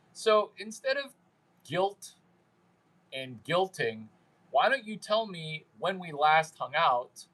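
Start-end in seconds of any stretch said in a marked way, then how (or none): none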